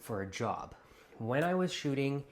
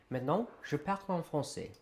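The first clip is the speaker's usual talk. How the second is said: speaking French; 4 semitones higher, faster than usual. Shown as a demonstration. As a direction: switch into French, quick. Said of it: "I'm going to speak in French".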